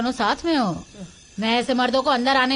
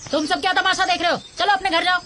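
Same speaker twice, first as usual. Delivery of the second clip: higher-pitched scolding order; 7 semitones higher than usual. Acted. Healer sends the onlookers home, scolding. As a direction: shoo the gawkers off sharply.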